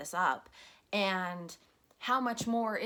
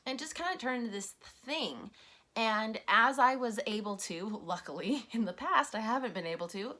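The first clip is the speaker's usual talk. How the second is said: usual speaking voice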